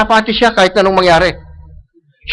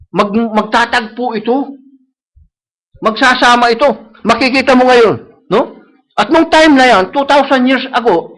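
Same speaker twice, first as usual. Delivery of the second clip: ordinary talk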